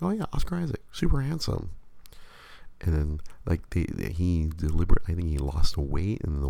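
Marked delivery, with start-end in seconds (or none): 0.0-1.7 gushing in a high mock voice; husband playfully imitating his own gushing. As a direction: swoon over him, hammed-up. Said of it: "I think I told her, like".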